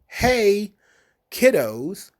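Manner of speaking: high, bright mock teacher greeting; hammed-up chirpy classroom hello; mock cheerful greeting. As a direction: sing it out like an overeager teacher. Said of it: "some of the things that were suggested".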